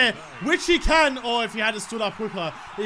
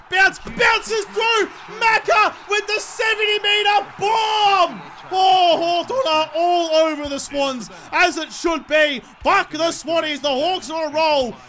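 high, loud, slowed goal call like a TV broadcaster; hammed-up sports commentary; mock commentator call; 6 semitones higher, 5 dB louder, slower than usual. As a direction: announce the goal like a play-by-play caller.

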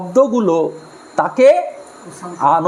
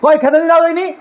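high, louder, impatient nagging for food; harsh demand; 8 semitones higher, 4 dB louder, much faster than usual. Shown as a demonstration. Family member demanding food at home, impatient and harsh. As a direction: snap the request for food rudely. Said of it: "এই সুরে কথা বলি".

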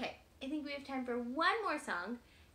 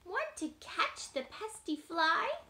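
slow proud rooster voice; 6 semitones higher, 6 dB louder, slower than usual. Acted. Rooster character voice.

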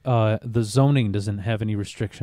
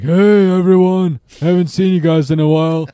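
puppet voice; 6 semitones higher, 10 dB louder than usual. Pretend. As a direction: call out like a ventriloquist dummy. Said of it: "and be like".

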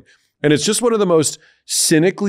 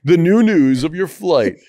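high, slow stuttered DJ intro; radio DJ; 5 semitones higher, 3 dB louder than usual. Pretend. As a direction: hype it like a DJ. DJ announcing the flight.

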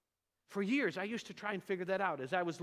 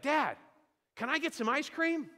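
put-on high, eager kid's plea, a bit louder; begging child; 9 semitones higher, 5 dB louder than usual. Son in the back seat begging dad for ice cream, high and eager. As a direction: pitch it up like a small boy asking for a treat.

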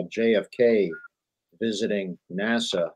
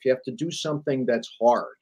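ordinary talk, nothing marked

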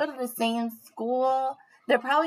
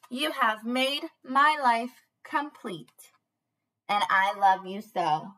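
slow, lingering lyric reading; reading to children; slower than usual. Acted. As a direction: read each line gently and slowly to little ones. Reads song lyrics aloud to children.

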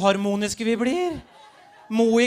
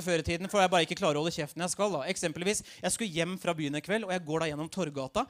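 usual speaking voice

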